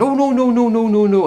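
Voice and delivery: high, loud, flustered no-no-no; flustered denial. Retold or imitated. Caught-out salesman denying it to the customer, high, loud and flustered.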